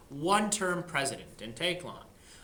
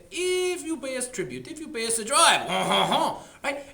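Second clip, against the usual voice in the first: higher, put-on voice that breaks into a laugh; sly French demand; 6 semitones higher, 5 dB louder than usual. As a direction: ask for a bribe with a chuckle. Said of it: "the French followed up by saying".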